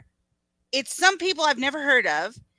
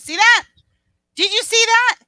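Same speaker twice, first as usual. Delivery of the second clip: high, loud insistent question; urgent exclaiming; 11 semitones higher, 9 dB louder than usual. Real, not acted. Streamer pointing at the shocking moment, loudly excited. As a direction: burst out loud and high, demanding they look.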